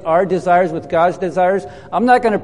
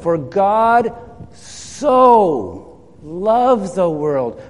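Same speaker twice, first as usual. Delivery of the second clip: slow, drawn-out emphasis; much slower than usual. Acted. Pastor lingering over the verse for the congregation, very slow. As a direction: stretch so loved the world out slowly.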